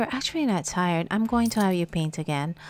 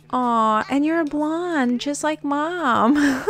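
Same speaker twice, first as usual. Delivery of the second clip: gushing coo; 7 semitones higher, 4 dB louder, slower than usual. Real, not acted. Cooing at new toddler.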